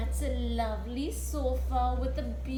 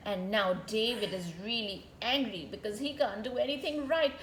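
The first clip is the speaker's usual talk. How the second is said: ordinary delivery, nothing marked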